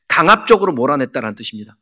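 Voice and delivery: ordinary talk, nothing marked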